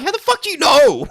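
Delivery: mock disbelief. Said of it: "I'm like".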